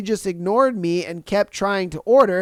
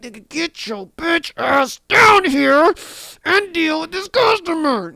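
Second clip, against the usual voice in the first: higher, slow, exasperated order to the boss; frustrated demand; 7 semitones higher than usual. Retold or imitated. Worker rants at his absent manager, high and exasperated. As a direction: drag it out, fed up with the manager. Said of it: "I'm like".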